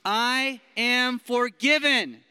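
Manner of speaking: slow, loud and high affirmation; loud declaration. Shown as a demonstration. Preacher leads the room in declaring, slow and loud. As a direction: shout it like you believe it. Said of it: "say 'em like you believe them".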